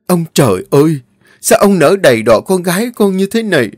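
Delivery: high, loud anguished wail; wailing to heaven. Acted. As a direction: cry out to the sky in despair. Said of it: "Cô muốn gào lên".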